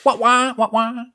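high, whining wah-wah door noise; whiny door sound. Retold or imitated. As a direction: imitate a whining sliding door. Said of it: "You sounded like a whiny shuttlecraft door".

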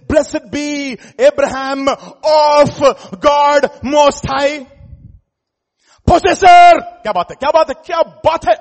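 high, shouted proclamation; full-force preaching. Real, not acted. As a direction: thunder out the blessing, stretching the words.

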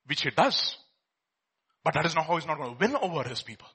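ordinary talk, nothing marked

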